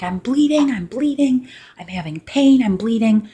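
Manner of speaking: high, loud panicked cry of I'm bleeding. Retold or imitated. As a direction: blurt it out in a high-pitched panic. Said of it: "I called the doctor freaking out".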